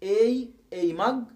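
اي stretched out slowly as the letter name ay. Shown as a demonstration. Teacher slowly modelling اي مج for students. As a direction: draw out اي slowly, then مج. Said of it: "أقولها اي باسمها".

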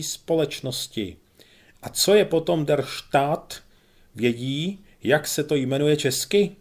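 a lightly voiced pompous major lecturing.

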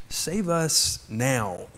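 "Save us now" said slowly, each word drawn out; slow down and let "Save us now" sink in; slow solemn repeat. Shown as a demonstration. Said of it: "a word that means save us now".

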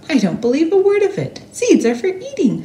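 high, fast squeaky mouse voice, scoffing; a mouse laughing off a silly idea; giggly scoffing mouse. Acted. Mouse doubting the teacher, high and quick. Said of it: "Mortimer giggled at the thought".

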